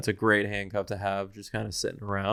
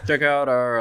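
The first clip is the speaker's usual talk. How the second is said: laughing; 4 semitones higher, 7 dB louder than usual. Real, not acted. Laughing after a botched outro.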